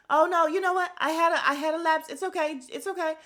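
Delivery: high-pitched imitation of flustered reassurance; mock backtracking. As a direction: start loud and high, then ease off. Daughter backtracking, retold.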